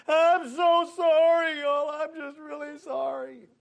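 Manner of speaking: put-on high, slow crying apology of a little boy; sobbing boy's apology. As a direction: beg forgiveness like a tearful kid. Boy apologizing, voiced by teller.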